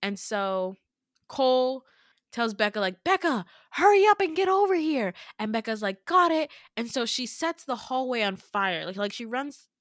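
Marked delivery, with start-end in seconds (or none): 3.0-5.1 much higher, louder urgent call. Retold or imitated. Cole calls Becca over mid-fight, urgent shout. 6.0-6.5 quick call back. Retold or imitated. Becca answering across the hallway.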